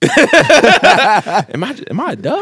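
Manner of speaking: high-pitched comic self-doubt; mock hurt. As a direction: whine it in joking disbelief, pitch way up. Joking hurt at being passed over.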